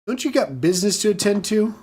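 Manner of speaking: ordinary talk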